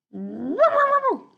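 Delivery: high, loud guard-dog barks; dog barking at intruders; warning barks. Acted. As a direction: bark to drive them off.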